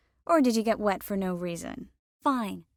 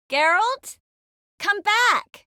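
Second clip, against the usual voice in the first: high, loud shout after someone; calling after him; 12 semitones higher, 6 dB louder, slower than usual. Acted. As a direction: holler for him to return. Calling after Geralt who leaves.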